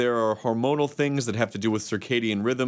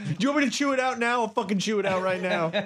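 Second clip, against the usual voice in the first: high-pitched, desperate comic pleading; hammed-up clingy begging, stretching the big words; codependent lion; 10 semitones higher than usual. Lion begging a loved one not to leave, codependent. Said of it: "Codependent Lion".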